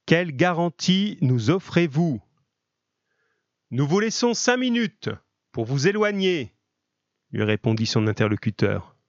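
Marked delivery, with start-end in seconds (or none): 0.0-2.2 called out much higher, a touch louder. A narrator's reading. A gendarme calls out loudly to the hidden terrorists. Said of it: "d'une voix forte". 3.7-6.5 calling out. Acted. Terrorist calls back to gendarmes.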